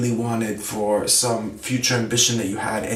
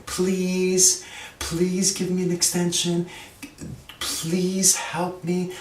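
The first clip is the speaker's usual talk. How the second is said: high begging voice stressing "please" and "help"; pleading please; 7 semitones higher, much slower than usual. Shown as a demonstration. Teacher shows a student begging for an extension, pleading. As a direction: lean hard on "please" and "help", slowly. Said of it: "we try to emphasize the please and the help".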